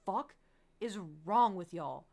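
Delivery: retold shocked yell at friends; shocked outburst. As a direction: blurt it out in disbelief. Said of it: "I'm like".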